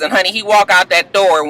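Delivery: normal talking voice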